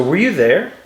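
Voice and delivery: "Were you there?" with pitch lifted high on you; questioning intonation. Shown as a demonstration. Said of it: "listen to those points of intonation".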